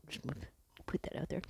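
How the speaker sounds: quick whispered aside. Real, not acted. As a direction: drop to a fast whisper, like sharing a secret. Aside about movie filming.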